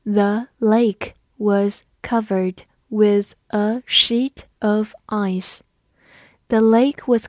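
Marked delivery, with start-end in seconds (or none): 0.0-5.6 slow, careful model reading; teacher reading slowly for the class. Shown as a demonstration. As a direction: read it slowly word by word. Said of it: "慢读一遍".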